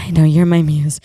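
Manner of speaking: loud mock-breathy retort after a heavy breath; breathy guy. Fan to celebrity, imitating a breathy guy. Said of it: "I was the breathy guy".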